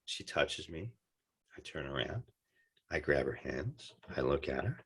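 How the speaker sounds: quietly reliving the tender moment; hushed retelling. Real, not acted. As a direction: drop it down to a hush.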